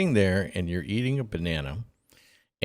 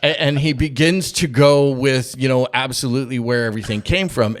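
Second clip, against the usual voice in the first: ordinary talk, nothing marked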